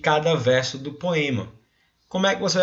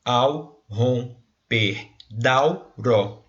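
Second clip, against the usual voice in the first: slow, counting up to the ró of "aurora"; slow scansion; much slower than usual. Shown as a demonstration. Teacher to class, counting syllables slowly. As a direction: scan "ao romper da aurora" syllable by syllable. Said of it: "a gente vai contar até essa".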